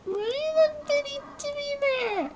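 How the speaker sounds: high exasperated whine. Real, not acted. Exasperated question.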